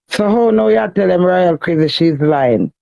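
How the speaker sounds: ordinary delivery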